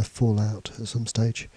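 normal talking voice

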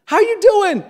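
put-on high, excited greeting to his little girl; light up with pitch climbing; dad thrilled to see daughter on FaceTime, excited high greeting; ecstatic dad. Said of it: "I'm ecstatic".

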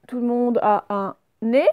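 ordinary delivery

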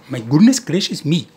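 light mock Indian accent; a playful Indian accent on a catchphrase. Indian accent catchphrase for his model railway fans. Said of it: "a bit of Indian stuff".

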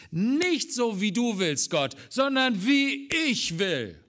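loud, high, defiant cry against God; defiant shout. Acted. Preacher voicing the rebellious human spirit to God, defiant shout. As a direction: fling it back at God, loudly.

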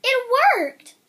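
loud, high burst of triumph; triumphant. A narrator's reading. Narrator celebrating the girl's success, a loud excited cry. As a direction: cheer as if the plan worked.

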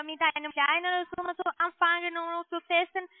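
usual speaking voice

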